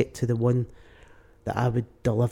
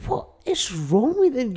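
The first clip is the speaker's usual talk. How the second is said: incredulous; 8 semitones higher than usual. Retold or imitated. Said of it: "And you're like".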